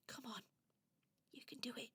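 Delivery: very soft whisper to oneself; hushed nervous pep talk; whispered self-encouragement. Acted. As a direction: breathe out, then whisper some courage. Elva nervously encourages herself.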